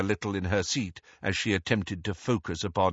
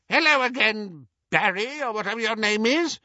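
put-on high-pitched, careless old lady; piercing elderly voice, offhand; dismissive old aunt; 13 semitones higher than usual. A narrator's reading. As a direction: greet dismissively in a shrill old voice. Muriel greets Harry dismissively.

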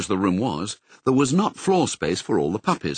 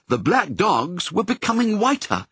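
ordinary delivery, nothing marked

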